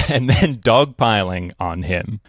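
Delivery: usual speaking voice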